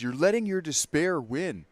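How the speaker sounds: mock scolding. Streamer mocking a preachy Trevor line, loud high scolding. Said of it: "what he didn't do is".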